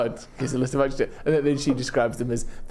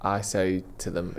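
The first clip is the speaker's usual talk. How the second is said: ordinary talk, nothing marked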